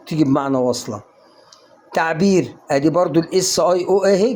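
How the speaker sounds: usual speaking voice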